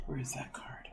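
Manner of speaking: whispered to herself; breathy whisper, just for herself. Real, not acted. Searching for a missing card.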